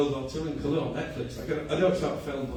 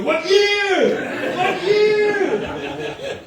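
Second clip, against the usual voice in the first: high, loud robotic repeat of what year; Terminator-style mum; 7 dB louder, much slower than usual. Retold or imitated. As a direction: hammer the question like a machine. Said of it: "She's gonna go".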